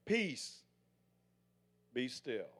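softly spoken calm command; hushed and steady, settling the storm. Retold or imitated. Jesus calming the storm, quiet steady command. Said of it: "he put his hand up and he said".

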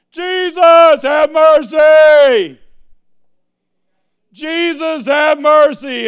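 long stretched high shout for mercy; desperate shout. Acted. Blind Bartimaeus shouting over the crowd, loud drawn-out plea. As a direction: scream over the crowd, slowly. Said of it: "crowd of thousands".

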